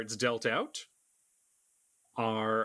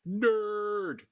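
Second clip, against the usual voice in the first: drawn-out, higher-pitched jeer; mock heckle; 9 semitones higher than usual. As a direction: heckle them playfully from the back. Heckler's jeer from behind in game.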